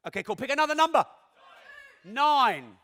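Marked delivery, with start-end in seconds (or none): none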